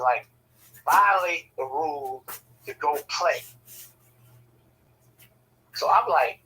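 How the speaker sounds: ordinary talk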